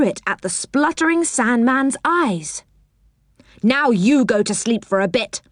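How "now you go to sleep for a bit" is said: plain reading voice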